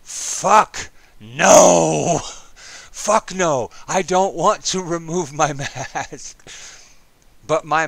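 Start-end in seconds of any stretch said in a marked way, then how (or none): 0.0-6.4 mock panicked yell; inner scream. Recruit's inner voice refusing to remove mask, yelling. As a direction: let the inner panic burst out loud. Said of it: "Every ounce of my being was screaming".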